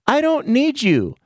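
imitating his mom. The mom turning her son away, high mock voice. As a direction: brush him off as his mother would.

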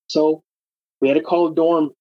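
ordinary talk

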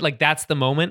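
ordinary talk, nothing marked

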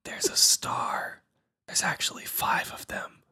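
slow, breath-only whisper; soft, voiceless stage whisper. Pretend. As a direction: coax a baby in a drawn-out whisper. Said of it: "really hard to talk like that".

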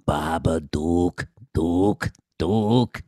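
very low, slow, drawn-out creepy chant; Babadook creature voice. Imitating the Babadook's low, slow creepy call. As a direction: croak it slowly like the monster. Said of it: "it would be going".

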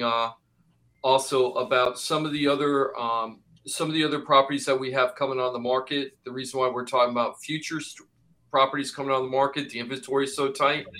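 ordinary talk, nothing marked

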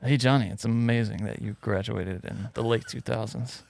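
respectful, soft cool-guy voice; understated, quiet manly compliment; Brad Pitt. Retold or imitated. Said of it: "Give it, like, a Brad Pitt kind of".

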